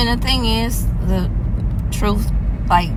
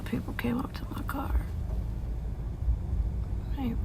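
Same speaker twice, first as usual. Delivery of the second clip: whisper; 4 semitones higher, 14 dB quieter than usual. Real, not acted. People approach her parked car.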